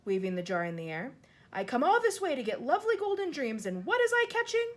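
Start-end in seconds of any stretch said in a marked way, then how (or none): 1.5-4.7 higher and louder, rising to a wail; cheated of golden dreams; distressed outcry. Acted. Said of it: "getting more distressed every moment".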